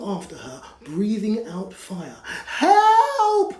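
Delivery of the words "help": much higher, louder desperate cry; cry for help. Acted. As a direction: shout help in terror. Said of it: "cried the witch".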